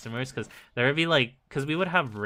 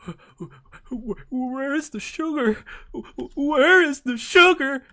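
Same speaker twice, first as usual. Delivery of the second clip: high, loud, stammering mock old man; confused old man; 13 semitones higher, 6 dB louder than usual. Old shopper confused and demanding sugar, high and stammering. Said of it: "He's like".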